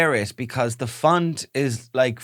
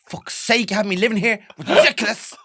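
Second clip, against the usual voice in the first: higher, louder, fast nagging wife; mock furious wife; 7 semitones higher, 5 dB louder than usual. Playing a wife scolding her husband over spaghetti. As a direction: rant like a fed-up Irish mammy. Pretend marital row.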